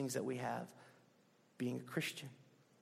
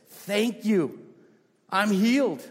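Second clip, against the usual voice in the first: louder, higher joyful cry of thanks; 5 semitones higher, 10 dB louder, slower than usual. Retold or imitated. Healed Samaritan at Jesus' feet, crying out loudly. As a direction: call it out, overjoyed. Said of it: "falls at Jesus' feet in a loud voice".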